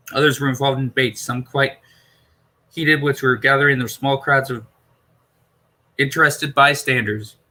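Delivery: ordinary delivery, nothing marked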